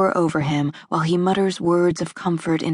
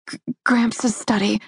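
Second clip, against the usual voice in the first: higher, stammering start; stuttering; 5 semitones higher than usual. A narrator's reading. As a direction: stutter from shock. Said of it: "I stutter".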